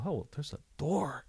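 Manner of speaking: high, mildly surprised realisation; retold discovery. Officer recounts spotting a door mid-chase, mildly surprised.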